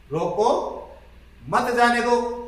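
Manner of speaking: "रोको", clear pause, then "मत जाने दो" run together; pause after रोको. Shown as a demonstration. Teacher showing students where the mark falls, pause after रोको. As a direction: break after "रोको", keep "मत" joined to "जाने दो". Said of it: "यहां पर ये विराम चिन्ह लगने के बाद".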